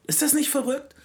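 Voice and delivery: high-pitched amazed question; incredulous. Real, not acted. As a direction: voice leaps way up in disbelief. Marvels at the absurdity.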